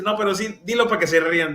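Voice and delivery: high, teasing friend; friend's teasing. Retold or imitated. Cuban friend urges the pastor to say it for laughs, high. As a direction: urge him on with a grin.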